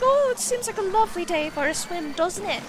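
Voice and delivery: put-on playful Irish-British accent; mock Irish-British accent. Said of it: "What is this accent?".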